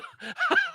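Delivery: squeaky laugh breaking out; a burst of high giggling; high giggle. Real, not acted. Laughs at own misreading.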